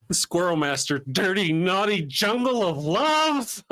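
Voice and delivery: high, slow goofy voice; hammed-up quote. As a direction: savor it comically. Quoting Half Baked.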